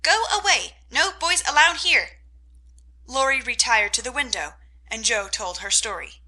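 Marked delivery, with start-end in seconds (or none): 0.0-2.2 high, loud parrot squawk. A narrator's reading. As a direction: screech it like a parrot. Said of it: "called out".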